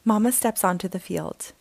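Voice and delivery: usual speaking voice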